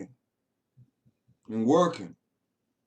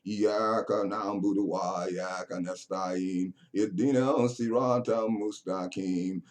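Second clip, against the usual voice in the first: soft, low chanted recitation; murmured Arabic Fatiha recitation; Quran recitation; 5 semitones lower, 4 dB quieter than usual. Acted. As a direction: recite it quietly as in prayer. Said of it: "That's what you're saying in the mosque".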